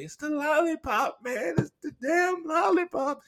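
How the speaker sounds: very high squealed insistence; high, giddy reenacted squeal; mock hysterics. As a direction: wail it as if helpless with giggles.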